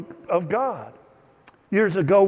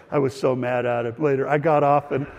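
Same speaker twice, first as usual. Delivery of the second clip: ordinary talk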